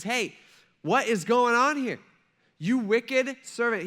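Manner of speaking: high, forceful scolding; angry master. Acted. Master confronting the wicked servant, angry. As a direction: confront him, stretching the accusation. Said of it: "because he was angry".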